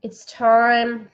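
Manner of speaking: unhurried guided relaxation for the live audience; guided breathing. Acted. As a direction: lead them into calm, one phrase at a time. Leads a breathing exercise.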